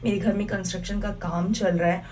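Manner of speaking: normal talking voice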